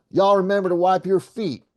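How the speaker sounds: high mama's holler. Acted. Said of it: "Mama hollered out the back door".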